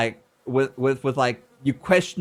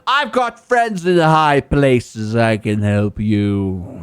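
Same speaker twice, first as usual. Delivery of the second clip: mock Chad; 6 semitones lower, 5 dB louder, slower than usual. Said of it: "he's a Chad".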